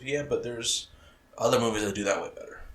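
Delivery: ordinary delivery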